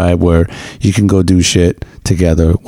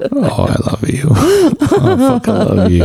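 mock tender reply. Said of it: "And I was just like".

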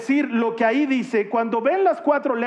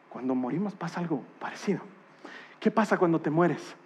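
much softer and lower; hushed and grave; 6 semitones lower, 8 dB quieter, faster than usual. Real, not acted. Preacher to the congregation on dying, hushed and solemn. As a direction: speak of death barely above a breath. Hushed talk about death.

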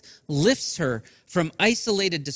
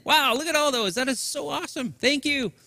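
ordinary delivery

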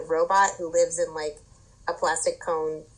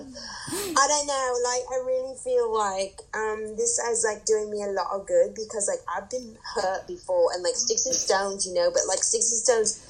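higher-pitched, halting confessional voice; earnest contestant; 4 semitones higher than usual. Acted. Contestant confessing hurt in a camera interview, mock earnest. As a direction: act a reality contestant opening up, full of likes.

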